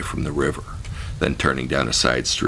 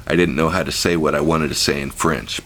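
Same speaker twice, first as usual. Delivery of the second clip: ordinary delivery, nothing marked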